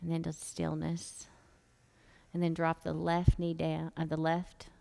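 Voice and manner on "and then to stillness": whispering. Real, not acted. Quiet yoga cue, measured whisper.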